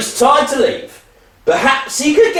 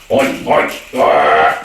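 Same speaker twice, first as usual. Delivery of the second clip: very low grunting oinks; pig noises; slower than usual. Acted. Actor playing a farm pig, low oinking.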